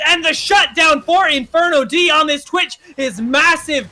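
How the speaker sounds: rising hype to a roaring call; excited shout. Real, not acted. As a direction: build to a big fight call. Teamfight climax in live casting.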